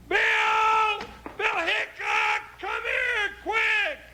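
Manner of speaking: shouting across town for Bill; high urgent shout; calling out. Acted. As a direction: yell for your partner to come quick. Calling out for his partner.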